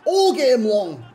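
loud, high shouted rant; exasperated ranting. Real, not acted. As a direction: shout your frustration at the game. Exasperated outburst at the game.

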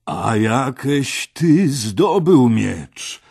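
probing question. A narrator's reading. Hektor questions Artur about the sword, slow probing demand. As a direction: press the youth for an answer.

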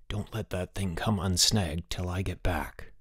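slow, hushed confidential aside. A narrator's reading. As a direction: drop low and soft, taking your time. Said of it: "in a lower voice".

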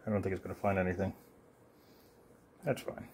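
ordinary talk

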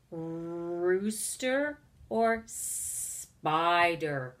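"Rooster" and "spider" very slow, first sounds held; stretched first sounds. Shown as a demonstration. Teacher has children compare, "Rooster" and "spider" with long openings. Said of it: "begins with the S sound".

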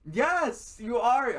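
high, loud comeback; real squabbling retort; insistent contradiction. Real, not acted. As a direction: insist loudly with the pitch way up. Heated contradiction in argument.

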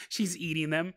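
usual speaking voice

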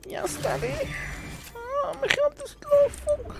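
whimpering very high fake sob; mock crying. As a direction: wail over lost money, hammed-up. Money gone, mock crying.

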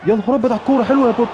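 high, loud stunned exclamation; excited yell. Real, not acted. Gamer cheers his player's move, loud excited shout. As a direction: cry out at the chance. Gamer reacting to near miss.

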